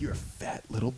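hushed taunt aimed at a pregnant belly; mock hushed taunt to belly. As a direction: taunt an unborn baby under your breath.

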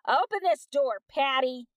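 loud, high call to open up; bang-on-the-door yell; shouting through the door. Acted. Angry neighbor yelling at the door.

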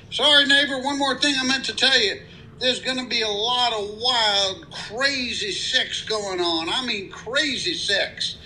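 high-pitched voice for the friendly country neighbor. Acted. Guy in pickup greeting new neighbor, high-pitched friendly voice.

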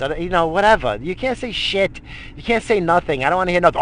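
normal talking voice

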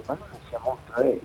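quietly wondering to himself; hushed to himself. Retold or imitated. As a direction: mutter the thought under your breath. Inner thought, hushed to himself.

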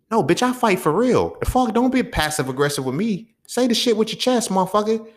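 high-pitched, heated callout; confrontational challenge. Acted. Challenging passive-aggressive college classmates to speak up, confrontational.

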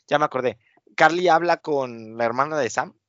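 usual speaking voice

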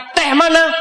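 a grumpy husband wanting his tea now; demanding husband. Acted. (suami menagih teh dengan nada tinggi)

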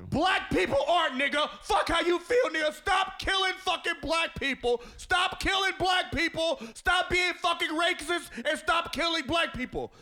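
high, slow shouted protest; impassioned shouting. Real, not acted. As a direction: shout each demand out, drawn out. Sudden shouted plea in mid chat.

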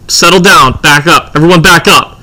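loud, urgent crowd-control orders; imitating commanding producer. TV producer facing an armed man, imitated loud command. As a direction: call out firmly as the alarmed producer.

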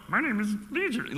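high, croaky little Yoda impression; Yoda's voice. Preacher imitating the demon as Yoda, hammed-up. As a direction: squeeze it up into a tiny Jedi master. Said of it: "like, Yoda's voice".

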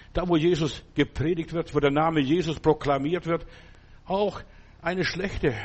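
ordinary delivery

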